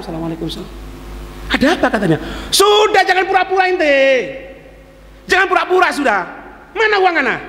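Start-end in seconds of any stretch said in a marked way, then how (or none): 2.5-7.4 angry accuser. Retold or imitated. Accuser demanding money from the Imam, higher mock anger. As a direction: bark for the money back.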